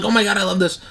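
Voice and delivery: hammed-up fan delight; gushing fan. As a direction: replay your teenage excitement, lightly hammed. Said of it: "I was like".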